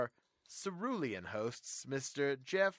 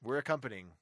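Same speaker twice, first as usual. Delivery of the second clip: normal talking voice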